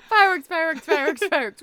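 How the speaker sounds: loud, high-pitched rapid chant; excited mock cheering. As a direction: hype up the fireworks in mock awe. Hammed re-enactment of the grand entrance.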